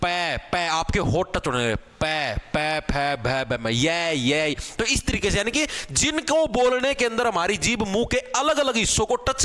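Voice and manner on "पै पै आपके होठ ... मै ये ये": पै repeated, lips meeting; teacher demonstrating lip sounds; sound demo. Shown as a demonstration. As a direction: show पै, फै, भै, मै one by one. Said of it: "पै बोलो".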